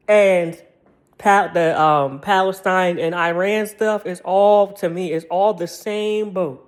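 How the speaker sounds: ordinary delivery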